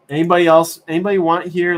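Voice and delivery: calling out. Real, not acted. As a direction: shout out to anyone listening. Calling out to chat.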